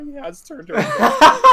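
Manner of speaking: high, loud laughing cry; hammed-up sob, voice cracking high; mock tearful. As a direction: ham up the sobbing Ben Shapiro. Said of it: "he's fighting back tears".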